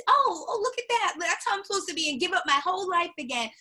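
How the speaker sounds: high, breathless mock gasp; mock naive viewer. Imitating TV viewers who copy Tinsley, high mock gasp. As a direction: play a gullible fan, high and fast. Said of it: "people are like".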